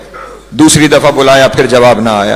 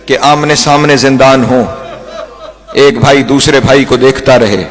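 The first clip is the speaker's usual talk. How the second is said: normal talking voice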